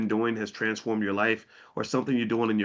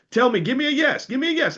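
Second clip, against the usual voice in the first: much higher, rallying the audience; excited call-out; 11 semitones higher, 3 dB louder than usual. Real, not acted. Host urging viewers to comment yes, excited and much higher. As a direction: whip up the crowd for a yes.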